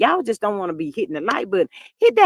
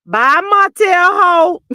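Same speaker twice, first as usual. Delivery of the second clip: louder, higher, slow drawn-out bye; sassy goodbye; 7 semitones higher, 6 dB louder, much slower than usual. Retold or imitated. Mel dismissing Martell with a drawn-out sassy bye. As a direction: draw out Martell Ho slowly. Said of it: "can't nobody say that name like Mel".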